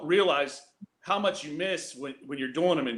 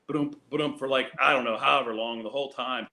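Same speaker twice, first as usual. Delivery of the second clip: ordinary delivery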